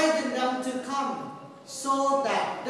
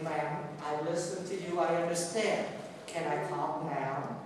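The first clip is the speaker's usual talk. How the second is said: softer, lower and very patient; calm, patient reply; 8 semitones lower, 8 dB quieter than usual. Retold or imitated. Priest patiently answering the angry woman, softly and calmly. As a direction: soothe her, unhurried.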